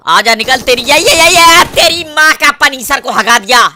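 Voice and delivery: loud, high triumphant shouting; excited yelling. Real, not acted. Gamer celebrating a kill to his viewers, loud excited yelling. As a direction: crow over the kill at full voice.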